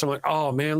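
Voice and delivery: ordinary delivery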